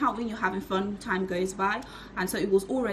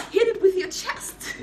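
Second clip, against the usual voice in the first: laughing; 12 semitones higher than usual. Shown as a demonstration. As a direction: shout it again through a laugh.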